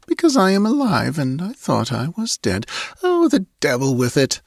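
put-on high, lightly puzzled girl's voice; murmuring girl. A narrator's reading. Girl puzzled that she is alive, murmuring. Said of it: "she murmured".

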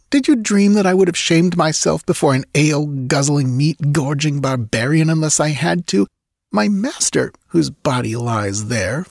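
high, scornful, cutting; disdainful young woman. Acted. Girl scorning the barbarian, high and disdainful. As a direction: look down on him with contempt.

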